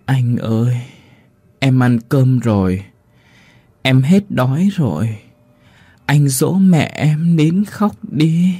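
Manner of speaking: put-on slow, distant child's voice, rising at the end; an eerie little ghost, calm and slow. A narrator's reading. Ghost child whispering by ear. (giọng hồn ma đứa bé thì thầm, chậm và xa xăm)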